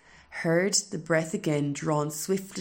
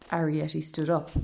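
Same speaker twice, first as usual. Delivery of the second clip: ordinary delivery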